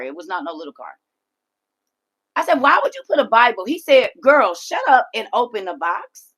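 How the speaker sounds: loud, high exasperated questioning; irritated. Retold or imitated. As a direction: let the irritation rise. Irritated retold argument in car.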